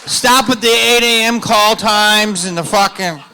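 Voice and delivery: loud, high comic hollering; hammed-up, high-pitched rallying shout; mock rant. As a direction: holler it out at the crowd.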